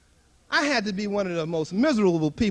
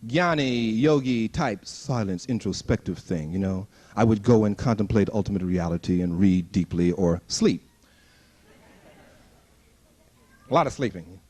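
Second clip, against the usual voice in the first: mock solemn yogi; 14 semitones lower than usual. Describing solemn introspection.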